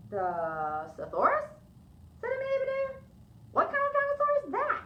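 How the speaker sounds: puzzled question. Acted. Amelia Bedelia puzzles over the strange word for the girl, rising high. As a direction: wonder aloud, confused.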